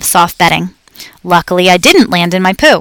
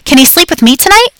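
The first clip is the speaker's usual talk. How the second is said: put-on excited child; 8 semitones higher than usual. A narrator's reading. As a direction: plead eagerly like an excited child. Excited kids clamoring.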